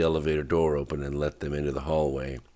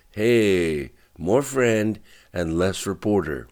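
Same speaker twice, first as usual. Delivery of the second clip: a little louder, a bit slow; voice raised a little; 4 semitones higher, 5 dB louder, slower than usual. A narrator's reading. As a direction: push back firmly, a notch louder. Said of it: "raising his voice a little".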